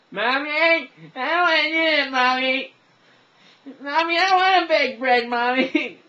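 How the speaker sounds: loud, high-pitched wail to Mommy; hammed-up bawling, very high; whining child impression. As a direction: whine at full volume like a protesting kid. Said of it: "I call this the challenge".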